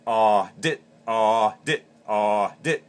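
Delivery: loud ah cut off by a sharp dit; ah-dit goose cluck. Shown as a demonstration. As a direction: say ah then clip it with dit. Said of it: "drop your hand back in and say the word dit".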